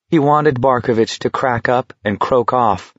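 ordinary delivery, nothing marked

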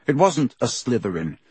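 ordinary delivery, nothing marked

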